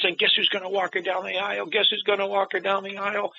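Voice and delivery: high, eager retelling of an excited woman; bubbling over like she can't contain the news. Retold or imitated. Woman tugging his jacket at the funeral, high and excited. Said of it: "excited, jumping up and down".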